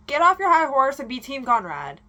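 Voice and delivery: ordinary talk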